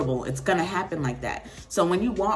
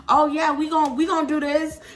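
mock eager; 9 semitones higher, 6 dB louder than usual. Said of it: "It's okay to be like".

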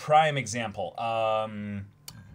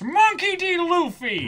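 high, loud cartoon captain shout; much higher, louder hammed-up hero voice; Luffy voice; 10 dB louder than usual. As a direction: belt the name like a boisterous boy captain. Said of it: "our voices for them".

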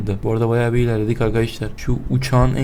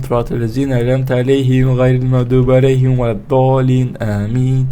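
steady chanted recital; rhythmic Quran recitation; prayer recitation; 3 dB louder than usual. Real, not acted. Recites Fatiha for luck.

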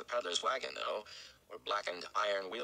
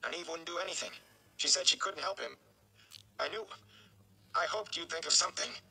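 higher, strained plea; pleading young man; 10 semitones higher than usual. Acted. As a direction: beg for a way to help. Rand pleads with the mayor.

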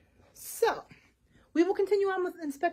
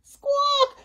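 loud, high parrot screech; squawking parrot; 13 semitones higher, 8 dB louder than usual. Acted.

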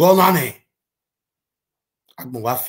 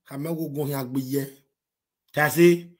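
ordinary delivery

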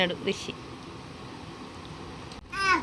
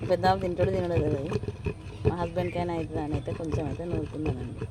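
softer, lower narration for the viewers; vlog voiceover; 4 semitones lower, 10 dB quieter than usual. Acted. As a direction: talk the viewers through the chutney, softly.

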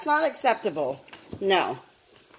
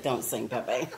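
voicing the dog; 6 semitones lower, 4 dB quieter, faster than usual. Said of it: "She's like".

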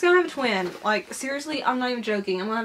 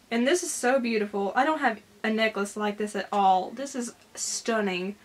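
normal talking voice

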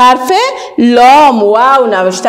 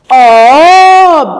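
slow and louder, stretching the vowel; drawn-out loud model word; 3 semitones higher, 6 dB louder, much slower than usual. Shown as a demonstration. Teacher modelling a word for children, slow and loud. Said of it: "و دیگه".

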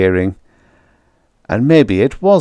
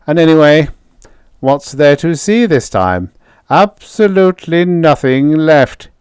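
dismissive scientist; 8 semitones higher, 5 dB louder than usual. Acted.

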